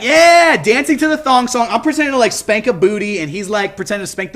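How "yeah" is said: high, loud hyped yeah; excited yeah. Retold or imitated. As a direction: cheer like the dancing guy. Mimics the dancing crackhead.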